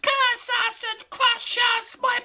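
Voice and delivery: high, loud shrieking creature; Gollum wailing. A narrator's reading. Gollum discovering his ring lost, shrieking. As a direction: shriek out the loss in despair.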